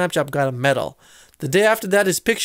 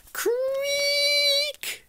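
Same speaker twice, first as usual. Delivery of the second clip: creak; 5 dB quieter than usual. A narrator's reading. Creaking trapdoor sound effect.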